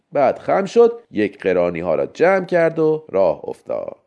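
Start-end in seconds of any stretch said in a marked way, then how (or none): none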